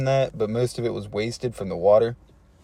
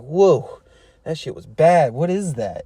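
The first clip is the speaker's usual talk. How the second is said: higher-pitched mock shock at a stench; retold disgusted surprise; 3 semitones higher, slower than usual. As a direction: recoil from a foul smell, acted out. Retold recoil from a foul smell.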